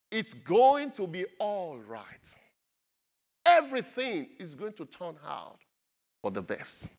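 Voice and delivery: slow it right down and comfort them; gentle reassurance. Acted. Preacher reading Jesus' words to the church, slow and comforting. Reads Message translation.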